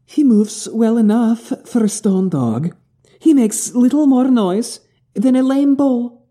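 high, drawn-out teasing character voice; Chiad. Acted. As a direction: tease a friend in a high, slow voice. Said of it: "Chiad leans over to Bane and goes".

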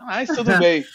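laughing while talking; self-mocking chuckle. Real, not acted. Laughing at own humiliation.

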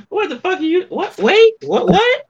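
very high, rising what-what-what; imitated disbelief. Guest mimics Isaiah's double take, very high pitched. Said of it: "Isaiah said".

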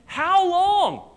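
high earnest call of "How long"; earnest plea. Acted. Lady Wisdom calling to the simple ones, high urgent plea. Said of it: "earnest question".

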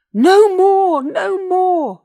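high, very loud drawn cry; desperate roar. A narrator's reading. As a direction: roar for mercy. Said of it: "roared the Tovosaurus".

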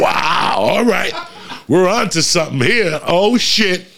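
high-pitched, wowed exclamations; acted-out giddy realization; amazed. Acted amazement at first all-nighter.

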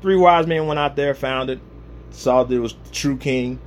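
ordinary talk

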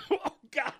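choking. Real, not acted. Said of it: "You all right, [name]?".

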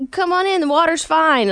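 high, welcoming Southern sweetness; overly nice Southern. Shown as a demonstration. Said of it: "Like, just overly nice".

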